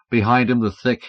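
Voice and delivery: usual speaking voice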